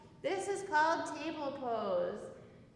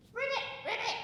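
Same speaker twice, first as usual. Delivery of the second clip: higher playful ribbit; frog croak; 7 semitones higher, much faster than usual. Pretend. Teacher croaking for the kids in frog pose, high. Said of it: "hop back to a frog".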